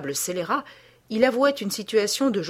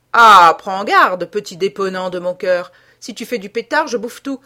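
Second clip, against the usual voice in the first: mocking threat; 11 dB louder than usual. A narrator's reading. The cadaver threatens the lawyer, loud mocking menace. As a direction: warn him loudly with sneering endearment.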